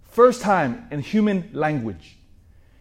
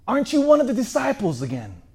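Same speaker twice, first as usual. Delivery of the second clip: suspicious accuser; 8 semitones higher than usual. Acted. Second accuser questions Peter.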